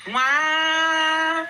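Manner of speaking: high, long drawn-out mock wail; imitated scream. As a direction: imitate a mother's scream, held on one high note. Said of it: "My mother started screaming".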